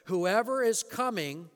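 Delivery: ordinary talk, nothing marked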